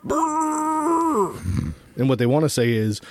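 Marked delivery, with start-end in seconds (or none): 0.1-1.7 high buzzing lip trill; silly lip trill. Pretend. Mock noise.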